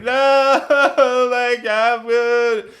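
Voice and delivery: high, loud, drawn-out mock wail; imitating a drunk woman's bawling; mock sobbing. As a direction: wail it slowly like a hammed-up drunk sob. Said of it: "Crystal is sobbing. She's like".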